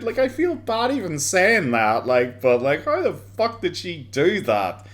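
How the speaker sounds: normal talking voice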